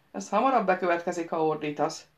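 plain reading voice

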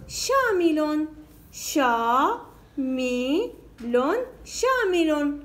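"شامل" slowly split into syllables; word split into syllables. Shown as a demonstration. Teacher dividing the word for pupils, slow syllables. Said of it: "تقطيع الكلمات".

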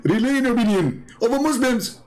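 the commenter's line read back high and mocking. Quoting Mister Submission's comment, high and mocking. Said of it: "This is Mister Submission".

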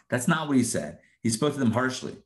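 ordinary talk, nothing marked

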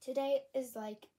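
breathless after running upstairs; out of breath, panting between words. Real, not acted. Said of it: "I'm out of breath from running up the stairs".

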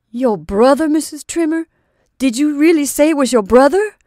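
high, loud startled disbelief; captain blurting out in shock; astonished question. A narrator's reading. Captain stunned by news of her brother, loud and incredulous.

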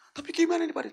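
very high, loud helpless plea; hammed-up distressed question; panicked, tearful. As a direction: wail it like a man in a panic. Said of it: "Kalau Bapak panik, nangis".

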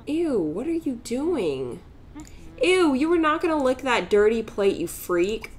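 mock disgust at a pet's habit; grossed out. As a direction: recoil at something gross. Sim licking a dirty plate.

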